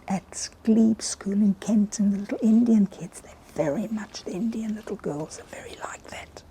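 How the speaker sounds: hushed whisper, barely voiced. Real, not acted. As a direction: drop to a secretive whisper. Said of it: "Why are you whispering?".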